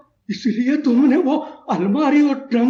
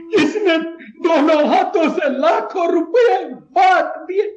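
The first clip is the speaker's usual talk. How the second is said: grief-stricken, halting outcry; anguished breakdown; 3 semitones higher than usual. Acted. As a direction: cry out in wounded humiliation. Shamed father breaks down.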